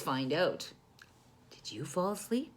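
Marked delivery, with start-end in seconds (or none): 1.5-2.5 put-on soft whisper to a sleeping child. A narrator's reading. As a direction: check gently if they're asleep.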